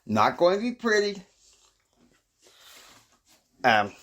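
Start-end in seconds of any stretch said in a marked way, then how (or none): 0.0-1.2 much higher, playful repeat of the warning; high sing-song echo. Shown as a demonstration. Host teasing the audience, high mock echo. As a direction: toss it back up high, teasing. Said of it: "It's not going to be pretty".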